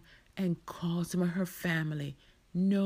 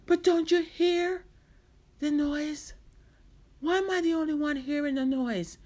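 high, raised-voice pleading questions; frustrated disbelief; 9 semitones higher, 5 dB louder than usual. Acted. Marcia questioning why only she hears it, frustrated. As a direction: insist that others must hear it.

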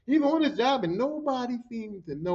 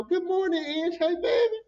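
high, singsong morning hello; affectionate greeting; 9 semitones higher than usual. Retold or imitated. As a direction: coo hello to a dear coworker. Sweet morning greeting to friend.

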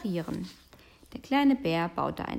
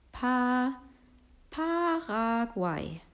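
"Paraguay" drawn out slowly, syllable by syllable; sounding out; 5 semitones higher, much slower than usual. Acted. Character who cannot read guesses at the signpost, sounding out. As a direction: read haltingly, holding "Pa" and "Paraguay" on high, level notes.

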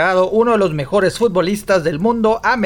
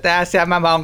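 ordinary talk, nothing marked

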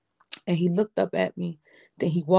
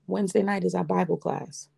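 normal talking voice

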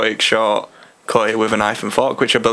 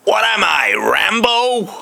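high, drawn-out American drawl; American accent; 10 semitones higher, much slower than usual. Pretend. Mimicking [name]'s comeback to [name], American accent. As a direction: put on an exaggerated American accent. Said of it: "in a more American accent".

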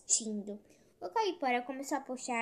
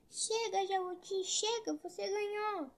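crying out; 8 semitones higher than usual. A narrator's reading. Caipora begs the tortoise to stop, crying out high. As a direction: plead to stop in a raised, high voice. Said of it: "Começou a gritar".

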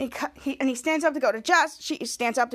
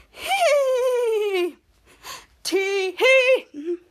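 mock giggle; 7 semitones higher, 3 dB louder, much slower than usual. Giggling after the song.

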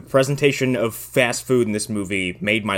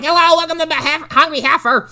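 much higher, louder nasal-free squeaky greeting; Mandar impression; 4 dB louder than usual. Podcaster mimics the jarring counter clerk, squeaky high. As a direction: pitch way up like a screechy clerk. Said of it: "Fucking Mandar got so distracting".

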